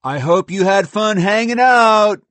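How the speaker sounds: loud, high, drawn-out jeer; bully's slow, high taunt; taunting yell. Acted. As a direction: yell it mockingly from a bus window. Bully jeers from bus window.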